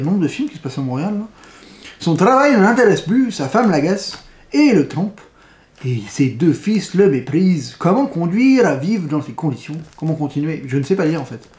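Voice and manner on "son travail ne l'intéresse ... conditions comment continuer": bad Quebec accent. Reviewer reading the film blurb to viewers in a bad Quebec accent. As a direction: put on a half-hearted Québécois accent. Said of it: "il est nul, mon accent".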